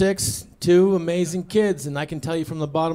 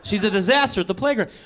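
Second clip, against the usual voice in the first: ordinary delivery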